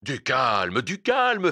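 sharp, loud order to calm down; the vendor barking at a frantic old man; brusque. A narrator's reading. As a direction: snap at him to settle down. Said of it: "fit brusquement le vendeur".

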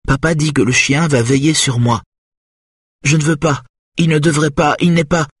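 put-on high childlike voice, sad and wondering; small child in trance. Hypnotized Charlotte tells the therapist about her papa, childlike and sad. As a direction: speak as a little girl seeing her sad papa.

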